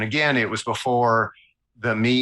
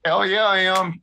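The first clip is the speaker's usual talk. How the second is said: higher-pitched retold burst of delight; re-enacted excited acceptance; eager yes; 8 semitones higher than usual. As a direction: jump at the offer, mock-eager. Retelling eager yes to job offer.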